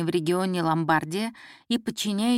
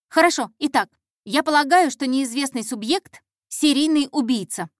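put-on higher, louder, urgent girl; breathless announcement; 6 semitones higher, 5 dB louder than usual. Intern giving her theory to the detective, breathlessly eager. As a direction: blurt out the big theory. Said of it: "выдохнула девушка".

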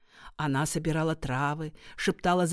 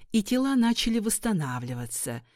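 usual speaking voice

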